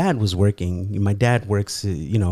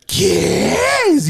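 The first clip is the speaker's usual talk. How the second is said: much higher alarmed cry in Spanish; shocked mom; 3 dB louder than usual. Retold or imitated. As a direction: gasp it out like a panicked mother. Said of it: "all of a sudden she's like".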